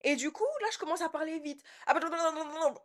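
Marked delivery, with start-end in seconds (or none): 1.9-2.7 rapid-fire bah bah bah, tripping over the words; flustered fast stammering, mock demo of losing your temper; fast flustered babble. Shown as a demonstration. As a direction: sputter it out fast like you can't get a word in. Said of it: "En gros, je parle comme ça".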